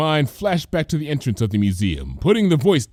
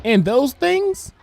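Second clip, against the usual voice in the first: much higher, slow little filly voice; high childlike question; curious filly; slower than usual. Acted. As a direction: point and ask like a small curious girl. Child Applebloom asks.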